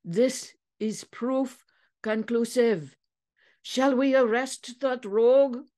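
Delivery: high, measured declaration; triumphant. A narrator's reading. Andre calls for arrest of the rogue, triumphant. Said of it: "said Andre triumphantly".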